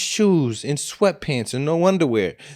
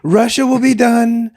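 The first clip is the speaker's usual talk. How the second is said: high, loud put-on politician voice; Trump impression; 8 semitones higher, 5 dB louder than usual. Repeating his campaign promises on ending wars, loud mock politician. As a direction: push out a big campaign promise, raised and forceful. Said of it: "It's a pretty good Trump impression".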